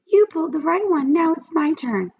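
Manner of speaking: whiny pigtail. A narrator's reading. The pigtail whines at Paul in a high voice. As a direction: drag it out like a sulky kid. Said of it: "whined the pigtail".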